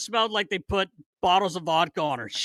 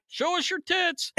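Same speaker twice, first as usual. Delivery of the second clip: heckle from the back; 12 semitones higher, 3 dB quieter than usual. Retold or imitated. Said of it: "you hear Litz in the back".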